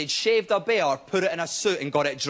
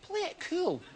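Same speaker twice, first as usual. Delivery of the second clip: cool inner voice; 11 semitones higher, 9 dB quieter, slower than usual. Acted. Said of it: "my brain went".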